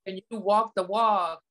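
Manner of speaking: high, gushing voice of admirers; admiring people. Retold or imitated. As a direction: gush like an impressed onlooker. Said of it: "people thought that".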